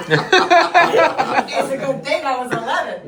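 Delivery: high, loud, laughing through the joke. Real, not acted. Wife teasing the room about her age, laughing loudly. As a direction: burst out laughing as you say it. Joking about being eleven.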